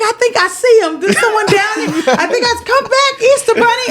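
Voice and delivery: put-on high hopeful kid voice; excited child. Little girl spotting someone, high and hopeful. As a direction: chase him down the street.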